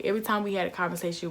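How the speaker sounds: ordinary talk, nothing marked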